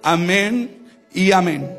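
ordinary talk

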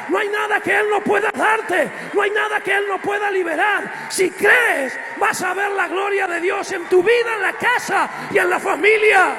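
a proclamation that builds and keeps pushing; full-force preaching. Acted. Preacher proclaiming God's power to the congregation, loud and fervent.

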